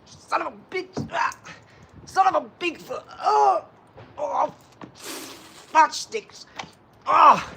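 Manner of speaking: high, strained cries of struggling to get up; acted-out struggle. Telling guys about being stuck on the floor, mock agonised cries. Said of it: "I was like".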